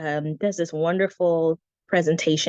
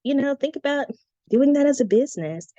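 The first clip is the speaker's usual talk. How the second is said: mom's higher-pitched advice, lightly imitated; a hint of a higher mom voice; lightly voiced mom; 8 semitones higher than usual. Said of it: "my mom was like".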